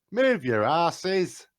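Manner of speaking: loud, high, drawn-out yell up the stairs; shout. Acted. Player's character calls up to allies blocking the stairs, shouting. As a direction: holler it up, stretching the words out. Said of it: "so I shout up".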